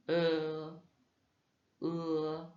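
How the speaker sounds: "E" like bleu but lips spread; unrounded e. Shown as a demonstration. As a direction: say "E" between e and eu, lips flat. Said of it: "c'est comme entre un E et un E".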